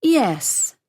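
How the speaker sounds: normal talking voice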